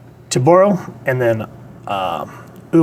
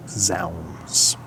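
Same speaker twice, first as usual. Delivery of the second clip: low, soft theatrical Silver Age Strange; a hushed archaic oath, dropped low; old-fashioned hero exclamation; 6 semitones lower, 9 dB quieter than usual. Retold or imitated. Reviewer voicing Silver Age Stephen Strange's entrance, low and hushed.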